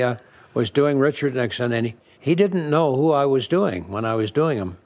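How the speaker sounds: normal talking voice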